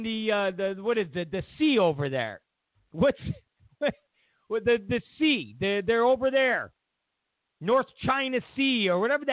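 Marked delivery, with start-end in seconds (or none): none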